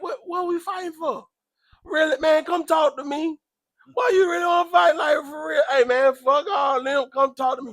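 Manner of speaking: high, pleading protest; retold challenge. Speaker replays confronting a would-be fighter, high-pitched challenge. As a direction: act out urging someone to talk it out.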